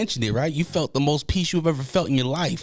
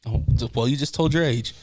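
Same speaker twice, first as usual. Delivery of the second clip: ordinary talk, nothing marked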